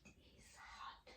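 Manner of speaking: soft unvoiced whisper, very quiet; whisper in other ear. Shown as a demonstration. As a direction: hush the words into the ear. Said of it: "Let me whisper".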